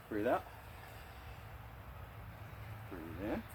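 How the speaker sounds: soft, spaced-out calls; guided movement cues. Acted. Instructor softly guiding class through Dead Bear. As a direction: lead each move calmly.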